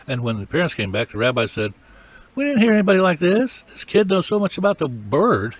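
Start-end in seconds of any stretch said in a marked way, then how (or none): 2.3-5.6 higher-pitched, astonished quote; amazed rabbis. Retold or imitated. Rabbis marvel to returning parents about the boy, amazed.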